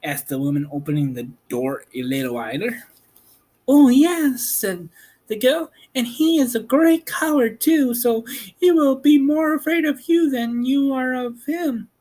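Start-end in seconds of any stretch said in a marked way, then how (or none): 3.7-11.9 put-on high young girl voice, curious; Dorothy's girl voice. Girl asking about Oz, high and curious. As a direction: voice the girl much higher.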